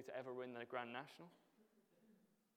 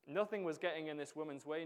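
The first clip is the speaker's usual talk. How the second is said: normal talking voice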